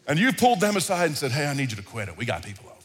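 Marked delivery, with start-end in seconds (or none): 1.3-2.7 low, hushed parent warning; quiet stern parent; hushed scolding. Retold or imitated. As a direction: scold the kids under your breath so guests won't hear. Parent hushing misbehaving kids.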